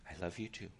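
soft, low retold endearment; imitating a quiet, loving reply; tender reply. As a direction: answer gently as the old husband. Old husband's tender reply, retold.

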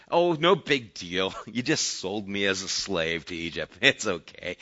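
mock-casual "no big deal" tone; mock dismissive. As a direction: pretend to wave it away, imitating false forgiveness. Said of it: "He doesn't say".

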